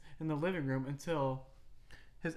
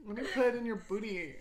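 mock dominant; 7 semitones higher, 4 dB louder, faster than usual. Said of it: "Could you imagine".

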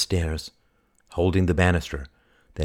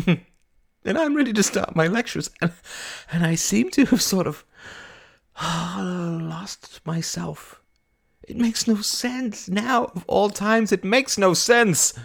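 high, slow, drawn-out wail; tearful despair; 13 semitones higher, slower than usual. Acted. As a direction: wail in bewilderment, sighing. Breakdown over losing herself.

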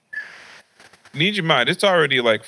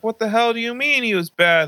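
higher, mock-outraged mother; mom flipping out; 11 semitones higher than usual. Mom confronts dad about his excuse, higher mock outrage. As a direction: pitch up in hammed-up outrage.

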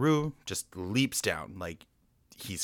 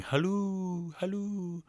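high, long-held singsong halloo; Tigger's call; 13 semitones higher, much slower than usual. Retold or imitated. Tigger calling out from up the tree, singsong call. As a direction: imitate a far-carrying call, drawn out and high. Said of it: "the call of Tigger going".

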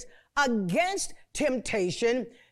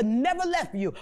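usual speaking voice

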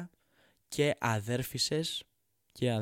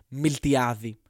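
shouted insult; 8 dB louder, much slower than usual. Real, not acted.

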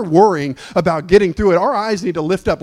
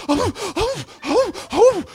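high, fluttering alarmed gasps; mock startled elders; slower than usual. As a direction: imitate frightened old folks.

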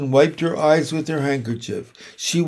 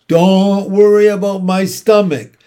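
louder, higher rat's snarl; 5 semitones higher, 7 dB louder than usual. A narrator's reading. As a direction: snarl it back, brushing off the scolding. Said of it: "snarled Templeton".

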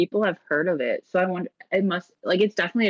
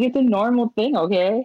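defensive; 5 semitones higher, 3 dB louder than usual. Retold or imitated. Defending her odd tradition.

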